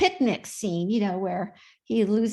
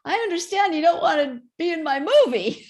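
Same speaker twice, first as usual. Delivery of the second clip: higher, louder mock-demanding caller; playfully confronting someone, pitched up; producer on the phone; 7 semitones higher, 4 dB louder than usual. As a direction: put on an insistent big-shot producer. Acts out producer's phone call.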